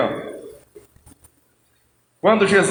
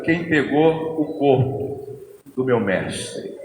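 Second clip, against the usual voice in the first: slow, soft, tearful question; a grieving woman asking through tears, slowly; chorando; 11 semitones lower, 6 dB quieter, slower than usual. A narrator's reading. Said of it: "E ela lá, chorando". (pergunta chorosa, lenta e baixa)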